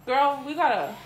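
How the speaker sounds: ordinary delivery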